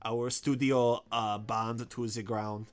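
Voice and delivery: put-on halting Japanese-accented studio announcement; mock Japanese accent.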